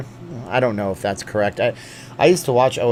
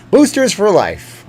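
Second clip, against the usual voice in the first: mock rally cry; 8 semitones higher, 6 dB louder, slower than usual. Chanting slogan.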